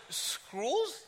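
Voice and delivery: high, rising "Scrolls?"; puzzled British receptionist. Retold or imitated. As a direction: imitate her baffled echo. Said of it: "And she goes".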